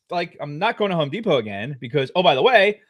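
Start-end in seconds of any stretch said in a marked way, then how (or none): none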